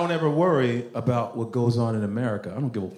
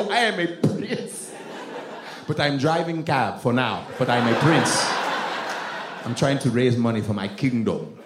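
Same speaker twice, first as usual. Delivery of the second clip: self-important Nigerian prince; Nigerian accent; 2 semitones lower than usual. Retold or imitated. Cab driver claiming he's a prince, grand Nigerian accent. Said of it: "That's what we like to say".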